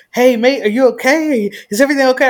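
mock concerned bloke checking on a fallen girl; imitating a concerned stranger. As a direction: play the stranger fussing over her. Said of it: "Irish dude comes up to me and he's like".

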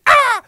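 very high, loud startled yelp. Retold or imitated. Kid yelping as the crow stares from across the street. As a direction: jolt at the crow again.

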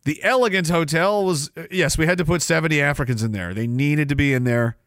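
normal talking voice

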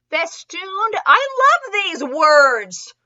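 high, loud, drawn-out amazement; delighted outburst. Real, not acted. Reader marveling at the story's word choice, delighted.

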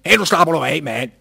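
mild mock yell at the stranger; retold shout. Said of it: "råbt til ham på dansk". (råber til den fremmede, gengivet)

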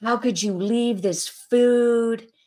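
loud, high, drawn-out mock scolding; exaggerated angry mother yell; re-enacted yelling. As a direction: wail the accusation at your child, very slowly. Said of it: "just yelling at him".

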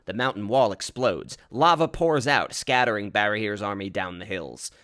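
plain reading voice

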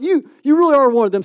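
high, loud, rapid accusation; insistent accusation. Acted. Crowd accusing the disciple of following Jesus, insistent. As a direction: point him out loudly and fast.

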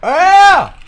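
sudden high loud yell; emphatic shout. Real, not acted. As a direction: blurt it out at the top of your voice.

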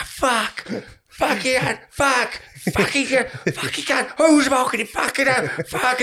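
high, despairing mock swearing from a fan; mock breakdown. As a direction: fall apart over the late equaliser. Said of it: "have a full-on fucking breakdown".

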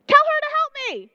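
high, loud, fast exasperated demand; a flustered sister who snaps and has had enough; frustrated outburst. Retold or imitated. Martha demanding that Jesus send her sister to help, in a high, loud, exasperated whine. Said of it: "she's frustrated and flustered".

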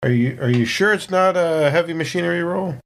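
normal talking voice

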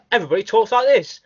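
high, loud put-on English accent; imitating the game's English voices; mock English accent. Mocking the English voice acting to his friends, put-on English accent. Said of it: "they've all got English".

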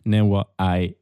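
usual speaking voice

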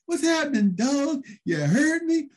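high, slow jive greeting; Curt Hennig in an afro wig. Retold or imitated. Wrestler in a wig greets his buddy with a high, slow hip drawl. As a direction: stretch it out, playing a hip cool cat. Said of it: "with his big afro wig".

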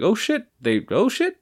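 high rising surprised gasp; retold shock at the tweet. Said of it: "and I was like".

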